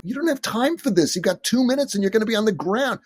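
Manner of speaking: high, hurried protest; urgent objection. Acted. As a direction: object urgently, pitched well up. Voices an imagined objection in crisis.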